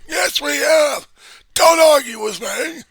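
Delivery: slow, high, full shout; arguing. Acted. Denier ending an argument with doubter, high shouting. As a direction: bellow it to shut the argument down. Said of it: "there goes the arguments right there".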